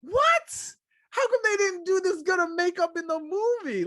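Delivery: very high astonished yelp; confused shock. Retold or imitated. Speaker reacting to the realistic makeup, very high shock. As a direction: blurt out in total disbelief. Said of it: "I was like".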